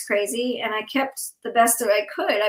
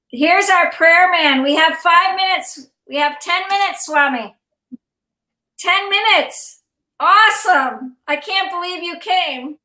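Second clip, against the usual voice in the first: much higher and louder, bursting with joy; thrilled welcome, real excitement; delighted welcome; 7 semitones higher, 6 dB louder than usual. Real, not acted. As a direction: greet an honored guest who just arrived. Greeting the arriving Swami excitedly.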